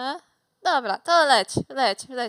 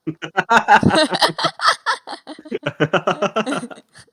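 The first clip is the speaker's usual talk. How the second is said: big burst of loud laughter; 2 semitones lower, 10 dB louder than usual. Real, not acted. Laughing at weather joke.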